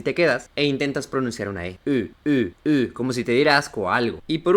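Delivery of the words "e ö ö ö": rounded German ö, held as a bare vowel; sonido de O umlaut. Shown as a demonstration. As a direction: say E with lips rounded for O. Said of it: "Pones la boca para pronunciar la O". (vocal ö alemana, labios de O diciendo E)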